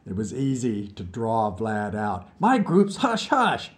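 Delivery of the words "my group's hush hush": higher, slow boast; bragging. A narrator's reading. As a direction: brag about the secret. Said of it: "he bragged criminally".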